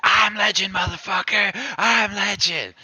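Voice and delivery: a friend shouting his nickname after the fight; imitating a screaming friend. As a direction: yell it up high like a brawler hyped after a fight. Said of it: "My dude screaming".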